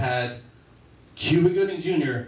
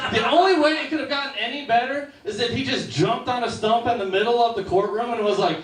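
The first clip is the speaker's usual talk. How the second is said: ordinary delivery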